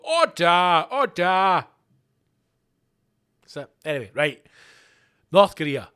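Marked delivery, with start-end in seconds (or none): 0.0-1.6 loud gavel-bang call for order. Shown as a demonstration. Podcast host showing how to silence the Christmas table with a loud call to order. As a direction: bellow it over a noisy table. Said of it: "bang the table wherever you're sitting and just go".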